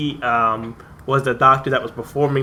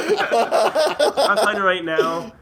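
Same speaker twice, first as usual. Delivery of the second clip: laughing hard; 9 semitones higher, much slower than usual. Real, not acted. Cracking up at own story.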